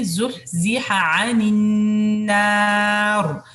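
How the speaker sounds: slow melodic tajweed recitation; Quran recitation. Shown as a demonstration. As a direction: chant the verse slowly and evenly.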